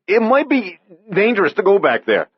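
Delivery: put-on young man calling his partner back in fear; urgent warning. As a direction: stop him, afraid. Andy warns of danger.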